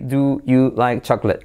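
careful textbook "Do you like chocolate"; separate words. Shown as a demonstration. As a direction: keep "Do" and "you" fully apart. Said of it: "tú aprender a decir".

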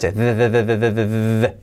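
"de" repeated as a buzzing voiced TH. Shown as a demonstration. Teacher demonstrates the TH for learners, voiced and repeated. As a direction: buzz a rapid, even "de, de, de". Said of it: "Y ese sonido sonoro".

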